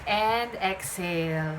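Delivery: slow, calm breathing cues; guided breathing. Acted. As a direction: lead the breath gently. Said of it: "please inhale with me".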